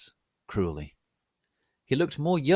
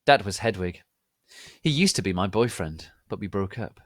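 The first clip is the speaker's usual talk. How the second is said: usual speaking voice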